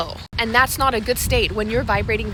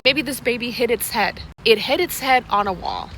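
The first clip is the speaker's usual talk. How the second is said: ordinary talk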